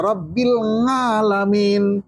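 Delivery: "Rabbil ngalamin" with a drawn-out NG starting alamin; nasal ng in place of ain in NGALAMIN; ain turned to ng. Shown as a demonstration. Teacher showing the forbidden reading, ain as ng. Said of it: "ainnya harus jelas".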